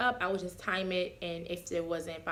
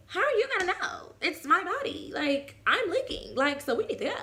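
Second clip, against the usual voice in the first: higher, insistent protest; indignant; 9 semitones higher than usual. Retold or imitated. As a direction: push back, it's your body. Arguing with partner about labor.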